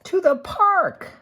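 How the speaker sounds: very high, slow joyful call; thrilled storybook exclamation; excited reveal. A narrator's reading. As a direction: announce it like a happy surprise. Big reveal after pause.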